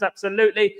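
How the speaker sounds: usual speaking voice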